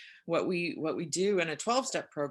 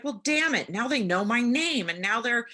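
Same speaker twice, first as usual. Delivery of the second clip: much higher and louder, hammed-up grumbling; mock exasperation; 7 semitones higher, 7 dB louder than usual. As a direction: play up the mock outrage at being roped in. Exasperated quote of herself.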